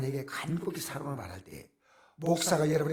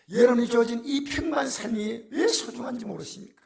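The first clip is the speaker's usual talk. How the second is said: tearful plea; 7 semitones higher, 7 dB louder than usual. Real, not acted. Pleading with congregation, voice cracking.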